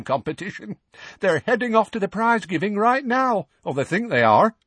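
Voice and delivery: put-on high, animated young woman's voice; young witch. A narrator's reading. Witch telling how she lured the Dursleys out, high excited voice. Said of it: "said the violet-haired woman".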